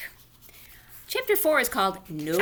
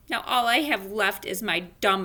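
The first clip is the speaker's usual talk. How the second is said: ordinary delivery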